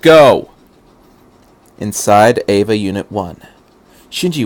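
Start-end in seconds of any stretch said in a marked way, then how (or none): 0.0-0.5 loud high shout. Acted. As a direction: snap the order out. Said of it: "Misato shouted".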